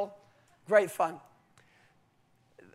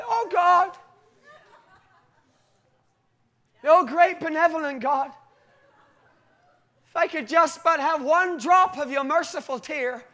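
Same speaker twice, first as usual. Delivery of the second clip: higher, louder hammed-up supplication; mock grovelling prayer; 5 semitones higher, 5 dB louder than usual. Believer begging God for one merciful tear, mock pleading. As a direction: imitate someone begging God with lofty reverence. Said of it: "how often do we treat God that way".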